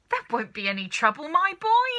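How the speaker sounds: high, loud brushing-off; excited cry. A narrator's reading. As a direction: wave the worry away. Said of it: "cried Mister Cranky".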